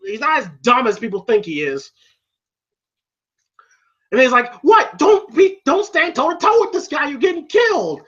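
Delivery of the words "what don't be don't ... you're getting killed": high-pitched cornerman's alarm; exasperated scolding. Retold or imitated. Trainer warning Rocky off toe to toe, exasperated. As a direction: imitate a trainer losing patience.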